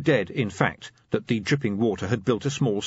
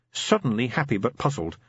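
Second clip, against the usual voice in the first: ordinary delivery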